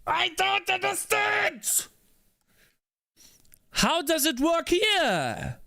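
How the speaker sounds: high, slow, exasperated cry of confusion; frustrated outburst. Real, not acted. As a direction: spit it out, completely fed up. Streamer stuck on pixel art.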